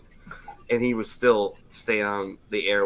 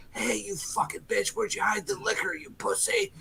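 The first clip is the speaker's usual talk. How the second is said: high, soft put-on voice of a raging drunk; mock the drunken vet, pitched way up; 11 semitones higher, 6 dB quieter than usual.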